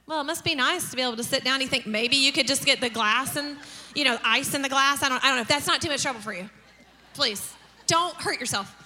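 acted passive-aggressive request; dripping mock concern, slowing at the end; sarcastic. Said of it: "get real sarcastic".

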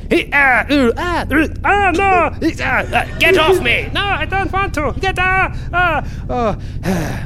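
high strained grunts and yelps, pushing hard; high struggling grunts. Acted. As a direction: strain and yelp as if shoving a crowd. Selves push and struggle.

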